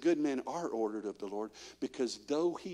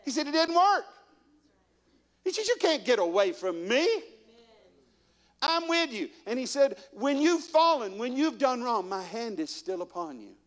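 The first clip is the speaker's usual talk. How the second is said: preaching at full force; 10 semitones higher than usual. Acted. Preacher urging the church, at full force. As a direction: preach it up high and ringing.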